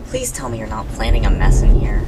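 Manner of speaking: loud, low scolding; loud stern warning. Acted. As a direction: warn them off sharply.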